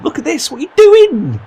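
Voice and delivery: high, loud disbelieving shout; exasperated yell. Real, not acted. Gamer yelling at his striker, exasperated shout. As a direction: shout at the screen.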